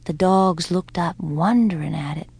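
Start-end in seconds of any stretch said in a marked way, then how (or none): none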